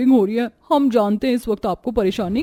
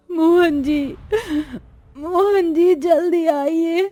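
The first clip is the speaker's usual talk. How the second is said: higher, tearful cries for her husband; crying for help; 5 semitones higher, slower than usual. Acted. Wife in labour calls her husband, crying for help. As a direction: call him through sobs of pain, slowly. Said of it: "वो मोहन को पुकारती है".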